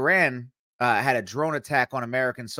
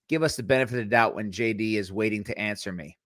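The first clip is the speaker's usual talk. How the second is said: ordinary talk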